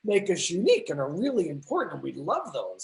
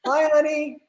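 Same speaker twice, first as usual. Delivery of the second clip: pet greeting; 7 semitones higher, 6 dB louder than usual. Real, not acted. Greeting the dog on camera.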